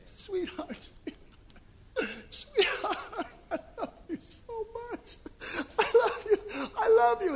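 high, tearful mock grief with a broken laugh; a mourner weeping over a loved one in the casket; grieving mourner. Mourner talking to corpse.

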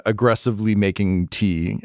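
usual speaking voice

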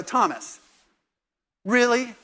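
Jesus needling Thomas, hammed-up exasperation; scolding. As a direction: tease him as a faith wimp. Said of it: "he's gonna go".

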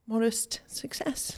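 whispered. Real, not acted. Confiding aside.

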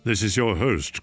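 ordinary talk

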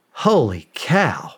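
normal talking voice

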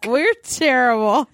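slow words breaking into real laughter; helpless self-mocking giggle; laughing. Real, not acted. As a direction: admit it while cracking up. Laughing at failed accent attempts.

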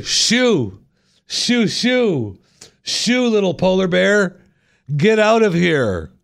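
high, drawn-out cries to chase off an animal; mock shooing. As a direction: shoo it away in a high, slow singsong call. Acting out scaring bears away.

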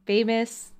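ordinary talk

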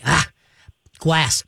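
normal talking voice

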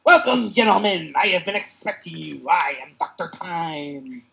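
loud, grand villain welcome; slow, gloating, theatrical; booming echoing villain monologue. Acted. Villain greets heroes.

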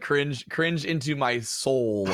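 drawn-out slow cringe. Real, not acted. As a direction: drag it out, pained. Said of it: "oh God".

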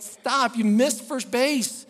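high, loud warning shout; urgent call to a runner overshooting; coach's yell. Retold or imitated. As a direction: yell it like an alarmed coach, drawing out the words. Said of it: "yells at her".

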